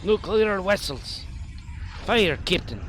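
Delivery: hammy mock Russian captain; fake Russian accent. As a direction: bark orders in fake Russian. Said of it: "Fake Russian!".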